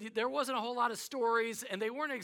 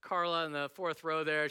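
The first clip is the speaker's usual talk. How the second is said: normal talking voice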